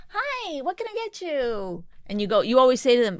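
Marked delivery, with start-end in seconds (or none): 0.1-1.8 very high, soft, sugary greeting; phony waiter voice. Retold or imitated. Server taking a restaurant order, put-on phony sweet voice. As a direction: slowly sing out a fake customer-service hello. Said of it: "You don't like that tone of voice".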